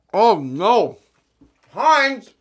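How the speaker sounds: loud high disgusted outburst. Real, not acted. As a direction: recoil at the taste. First taste of mustard, disgust.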